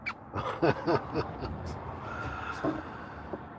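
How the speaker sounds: soft, breathy chuckling fit; real amused chuckle; real laughing. Real, not acted. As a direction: laugh quietly to yourself, helplessly. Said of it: "I'm just laughing here".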